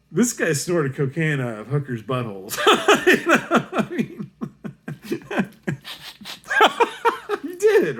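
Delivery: loud laughter breaking through the words; cracking up. Real, not acted. Storyteller on his student's wild exploits, real helpless laughing. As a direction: lose it laughing, pitch climbing high.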